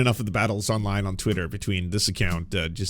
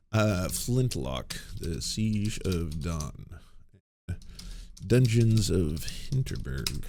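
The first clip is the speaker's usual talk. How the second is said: slow typing voice; 3 semitones lower, slower than usual. Real, not acted. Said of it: "I just wanted to see".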